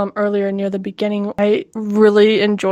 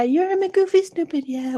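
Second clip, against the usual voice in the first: very high silly voice; goofy voice; 8 semitones higher, 4 dB quieter than usual. Pretend. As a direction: say it like a goofy cartoon.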